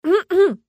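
loud, high throat-clearing; sudden awkward ahem; staged cough. A narrator's reading. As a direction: cough to cover a blunder. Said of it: "и закашлялась".

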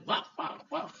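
soft voiceless smack noises; imitated slaps. Teacher acting out slapping someone, soft imitated smacks. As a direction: make three quick slap sounds.

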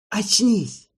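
loud, high harsh bark. Acted. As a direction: bark the order at him. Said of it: "рявкнул кто-то незнакомым голосом".

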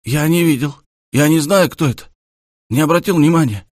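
high, loud nervous denial; flustered. A narrator's reading. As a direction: blurt it out, panicky and caught. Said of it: "Парень ужасно смутился, задёргался".

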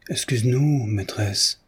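plain reading voice

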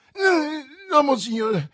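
high, loud panicked exclamation; exclaimed. A narrator's reading. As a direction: backpedal in a frantic high cry. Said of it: "esclamò Bonacieux".

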